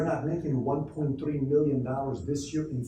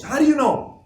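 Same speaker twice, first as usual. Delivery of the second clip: very high, loud outburst; shrieked, disbelieving comeback; indignant challenge; 13 semitones higher, 10 dB louder than usual. Retold or imitated. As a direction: throw the question back in acted outrage. Acted son challenging dad in quarrel.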